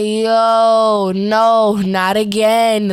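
dreading a repeat, crying out; exasperated alarm. Retold or imitated. Seeing her sister's mouth lock open mid-prayer, loud dismayed protest.

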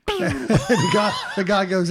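loud laughing narration. Real, not acted. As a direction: laugh through the telling. Laughing while telling.

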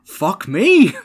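high, loud exasperated curse; mock principal outburst. Principal answering the pupil who swore at him, loud mock curse. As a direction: blurt it like a fed-up headteacher. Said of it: "And he's like".

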